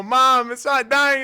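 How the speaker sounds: loud, high, drawn-out call to mom; panicked kid's cry. Retold or imitated. Kid running to mom about the mole, panicked retold cry. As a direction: imitate a distressed child yelling for help. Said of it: "I go get my mom and go".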